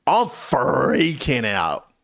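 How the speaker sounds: slow, stretched-out freak-out; mock panic. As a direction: draw out the word in hammed-up panic. Acting out his panic climax.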